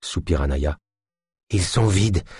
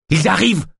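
high-pitched urgent warning; alarmed cry; 10 semitones higher than usual. Acted. Yosp warns the others that they are coming, alarmed. Frightened character cuts in with alarm.

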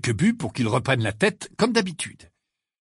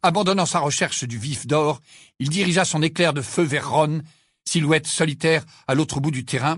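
ordinary delivery, nothing marked

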